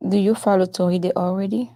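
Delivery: ordinary talk, nothing marked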